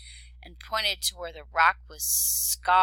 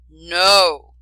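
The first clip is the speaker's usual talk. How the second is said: growled; 16 dB louder than usual. A narrator's reading. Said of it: "growled the dragon".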